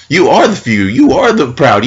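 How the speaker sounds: ordinary talk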